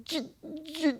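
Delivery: high, strained stuck syllables; choked, high-pitched stutter, acting out paralysis; stammering, held down. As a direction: struggle to force out the first sound of a word, breath catching. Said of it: "I could only get the J and the E out".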